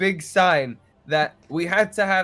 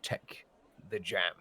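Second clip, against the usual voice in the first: much softer and lower, dead-eyed command; menacing quiet order; 9 semitones lower, 12 dB quieter, slower than usual. Acted. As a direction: press it down low and cold, almost under the breath. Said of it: "looks at you dead in the fucking eyes and goes".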